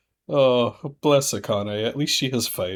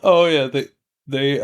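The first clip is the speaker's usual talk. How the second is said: ordinary delivery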